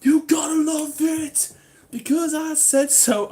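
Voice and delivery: high, loud jokey demand; mock bossy shout. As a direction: shout it like a hammed-up tyrant. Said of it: "I'm only joking".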